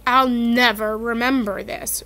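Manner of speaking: plain reading voice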